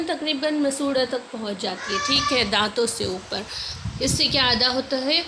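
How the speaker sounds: usual speaking voice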